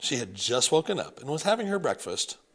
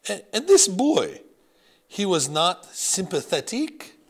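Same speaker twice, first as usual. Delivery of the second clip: put-on higher, louder, slow innocent question in French accent; 7 semitones higher, 7 dB louder than usual. Grandmother innocently questioning grandson, French accent. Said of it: "she asked innocently".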